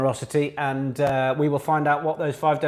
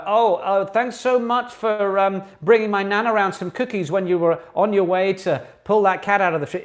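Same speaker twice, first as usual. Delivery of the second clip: mock gushing thanks; 5 semitones higher, 5 dB louder than usual. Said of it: "just saying".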